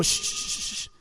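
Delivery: repeated soft "shh". Acted. Jesus silencing the messengers for Jairo, shushing. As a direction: hush the doubters with long breaths.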